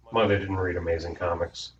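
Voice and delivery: ordinary delivery, nothing marked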